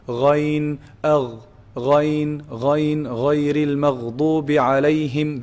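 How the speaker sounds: "Ghain" voiced from the top of the throat, held long; Arabic ghain. Shown as a demonstration. Teacher showing ghain in a recited phrase, throaty gh held. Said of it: "That's the ghain".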